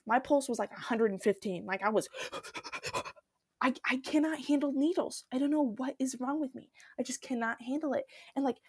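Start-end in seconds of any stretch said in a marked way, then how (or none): 2.1-3.1 quick, quiet panting breaths; mock panic breathing; panicked gasping. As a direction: act out hyperventilating with anxiety. Acts out panic before blood draw.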